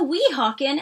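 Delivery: usual speaking voice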